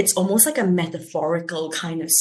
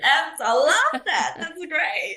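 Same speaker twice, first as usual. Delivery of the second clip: laughing; 8 semitones higher, slower than usual. Real, not acted. Laughing at Singlish request.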